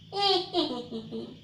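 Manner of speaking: high wicked witch cackle; evil cackle. Acted. Witch laughs after threat.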